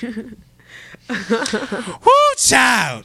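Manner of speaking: high, loud swooning over a crush; swooning squeal. Real, not acted. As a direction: swoon out the name, drawn out.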